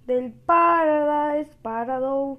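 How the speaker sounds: loud, drawn-out showy name; grand announcement. Pretend. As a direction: belt out the weapon name, stretching the vowels. Announces weapon name with flourish.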